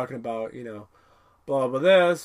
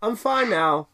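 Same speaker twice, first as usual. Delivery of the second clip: high, loud cheery insistence that fades down; Zoidberg insisting he's fine; 7 semitones higher, 4 dB louder than usual. Retold or imitated. Zoidberg reassuring the crew, high and eager. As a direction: chirp that you're healthy, then settle. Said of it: "Zoidberg's like".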